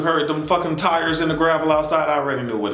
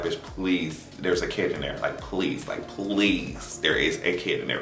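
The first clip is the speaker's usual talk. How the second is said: soft, lower pleading; pleading softly; 9 semitones lower, 8 dB quieter than usual. Retold or imitated. Faz begging the police not to shoot, soft pleading. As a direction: beg them quietly to stop. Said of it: "Faz is like".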